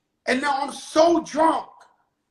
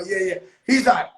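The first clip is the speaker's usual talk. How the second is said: usual speaking voice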